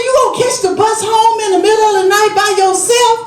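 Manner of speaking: high, fast disbelieving question; can't believe she did that; rapid high shocked scolding; incredulous scolding. Retold or imitated. Scolding mom's late bus ride.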